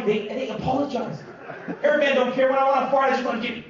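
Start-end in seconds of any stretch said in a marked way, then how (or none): none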